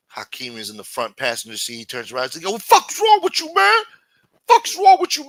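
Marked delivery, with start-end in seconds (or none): 2.4-5.3 very high, loud mock falsetto outburst; squealed character voice. Hakeem turning round to scold the shooter, shrill squealed voice. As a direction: shriek it like a cartoonish outraged accomplice. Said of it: "is that how he talks?".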